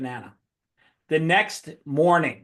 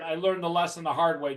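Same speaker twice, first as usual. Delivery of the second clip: usual speaking voice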